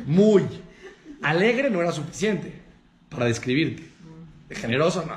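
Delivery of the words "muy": "Muy" said loud and drawn out; énfasis en muy. Shown as a demonstration. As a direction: lean hard on "Muy". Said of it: "¿No se dieron cuenta?". ("Muy" enfatizado y fuerte)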